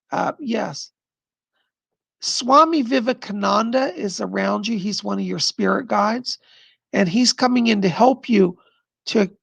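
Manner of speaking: usual speaking voice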